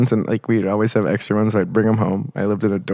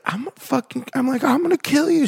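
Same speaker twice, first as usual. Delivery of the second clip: very high shrieking threat; squeaky high mock-threat of a crazed bar patron; crazed lady yelling. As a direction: shriek it like an unhinged drunk woman. Drunk lady threatening with glass.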